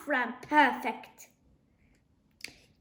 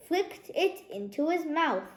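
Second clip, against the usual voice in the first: ordinary delivery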